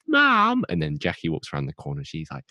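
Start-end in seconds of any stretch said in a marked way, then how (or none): 0.1-0.6 high, loud mock yell; calling out for mum. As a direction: holler through the house for your mother. Acting Rose calling for her mother.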